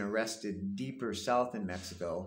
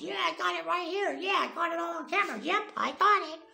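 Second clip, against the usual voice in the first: much higher, louder imitation of a yelling guy; guy filming, mock yelled in a much higher voice; mock screaming; 5 dB louder than usual. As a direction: ham up someone yelling at the top of his lungs. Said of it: "screaming at the top of his lungs".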